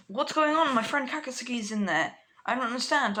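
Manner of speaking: high-pitched, confused urgency; anxious pleading. Acted. Deku to heroes about his trapped friend, anxious.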